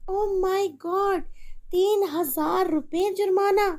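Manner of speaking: stunned by the amount; shocked. Acted. Mother shocked by fine.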